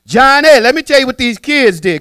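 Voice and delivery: high, loud call to Johnny; mama loudly reporting the kids. Retold or imitated. Mama calling her husband about the kids, loud and high.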